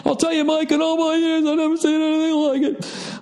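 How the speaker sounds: announcer marvelling to his co-host Mike; sportscaster. Pretend. Mock sports commentary.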